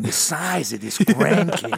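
slow, awed grandpa boast; bragging grandpa. Retold or imitated. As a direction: marvel slowly at his size. Vern impression bragging.

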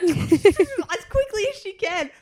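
slow, high and laughing. Real, not acted. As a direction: laugh through the words. Teasing about fast eating.

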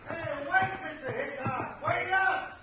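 far-off holler to stop; distant calling out. Acted. Sheriff calls Hickok to wait, distant shout. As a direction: yell after departing riders from a distance.

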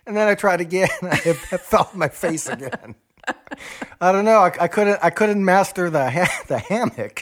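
high, loud laughing through words; giggling while telling own mishap; stifled giggles. Real, not acted. Laughing about falling from hammock.

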